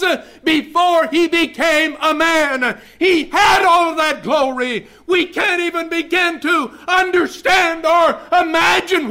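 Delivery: high, loud sermon building to a shout; full-force preaching. Real, not acted. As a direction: drive the message home at the top of your voice.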